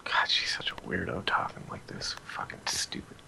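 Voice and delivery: hushed whispered aside; whispering. Real, not acted. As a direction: whisper it so she can't hear. Aside complaint muttered off-mic.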